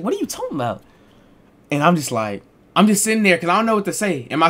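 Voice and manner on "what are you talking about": confused mom. Retold or imitated.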